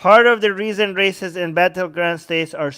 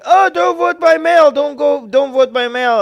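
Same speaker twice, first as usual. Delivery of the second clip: lightly voiced Trump, higher and louder; a hint of Trump lecturing supporters; mock Trump; 8 semitones higher, 5 dB louder than usual. Streamer mocks Trump warning voters off mail, higher mock voice. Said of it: "After weeks of Trump saying".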